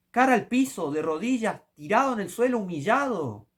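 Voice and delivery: preaching hard on begging God for salvation; fiery preaching. Acted. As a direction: drive it home like a pulpit preacher. Preaching at full force.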